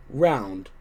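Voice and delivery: ordinary delivery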